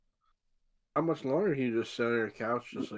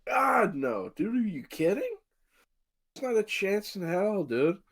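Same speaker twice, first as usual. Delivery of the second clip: emphatic incredulous refusal; 6 semitones higher, slower than usual. Real, not acted. Emphatically refusing to get the shot.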